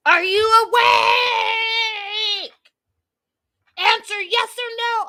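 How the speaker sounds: high, drawn-out screeched demand, hammed up; over-the-top shouted impression, words stretched out high; mock aggressive yell. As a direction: imitate an aggressive caller shrieking down the phone. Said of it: "all aggressive and says".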